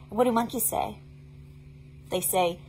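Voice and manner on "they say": ordinary delivery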